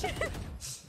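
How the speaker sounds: high breathy villain cackle; villain laugh. Pretend. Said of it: "That's too much".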